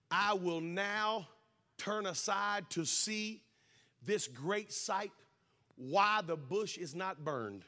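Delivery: very slow, measured Bible quote; scripture recitation. Retold or imitated. Preacher quoting Moses at the burning bush, slow recitation. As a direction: draw out each phrase of the verse with pauses.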